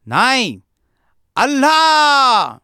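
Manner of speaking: very high, loud roar with a long drawn-out last word; booming enraged correction at full voice; thundering shout. A narrator's reading. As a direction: thunder it out, angrier and bigger. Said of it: "तो पुन्हा कडाडला".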